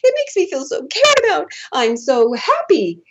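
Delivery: very high, drawn-out mock-weepy joy; hammed-up tearful delight, tiny high voice; inner voice, happy. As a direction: squeal it slowly as if melting with happiness. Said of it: "some place inside of me that's going".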